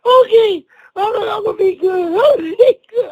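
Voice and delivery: high voice bursting with real laughter; laughing uncontrollably. Real, not acted. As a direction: try and fail to compose yourself. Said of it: "I can laugh now".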